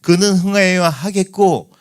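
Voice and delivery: preaching the verse loudly; emphatic proclamation. Shown as a demonstration. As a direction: proclaim it with force. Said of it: "그러니까".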